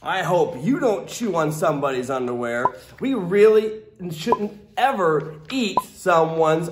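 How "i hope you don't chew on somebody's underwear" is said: ordinary delivery, nothing marked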